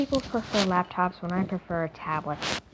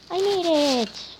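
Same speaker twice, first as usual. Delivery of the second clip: drawn-out, high-pitched happy cheer; celebrate, stretching the words out high; excited cheer; 8 semitones higher, slower than usual. Real, not acted. Gamer cheering after clearing an obstacle, excited.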